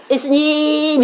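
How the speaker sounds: imitating 예수님 called from inside, high and held long; held-back child's call. Mother imitates children who cannot shout, held-back call. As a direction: draw out 예수님 without letting it burst out. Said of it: "그냥 속에서".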